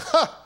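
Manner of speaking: short, very high loud ha; Sarah's startled laugh; mock laugh. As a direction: bark out a single scoffing laugh. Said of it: "and she laughed".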